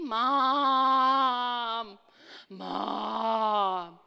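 loud drawn-out Mom calls; calling mom. Retold or imitated. Child shouting for mom to get attention, loud and drawn out. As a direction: holler across the house.

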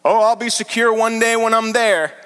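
a hint of a smug churchgoer; mock complacent. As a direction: toss it off like a self-satisfied believer. Mocking a complacent believer.

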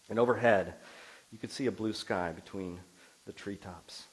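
storybook read-aloud, gentle and unhurried; story reading. Acted. Pastor reading a story passage to the congregation, gentle read-aloud.

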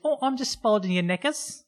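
high, chirpy mock British accent; a bright put-on British housekeeper; Daphne's British voice. Daphne explaining the laundry to Frasier, high mock British accent. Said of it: "And she's like".

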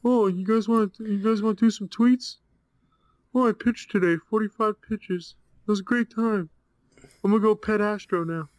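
sing-song mocking impression of a cheery pitcher; mocking David Price. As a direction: lampoon him as chirpy and carefree. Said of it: "David Price, please be like him instead of".

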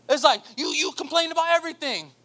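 usual speaking voice

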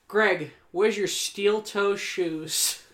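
slow, dead serious question; slow deadpan. Retold or imitated. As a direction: draw it out, deadpan. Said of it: "he goes".

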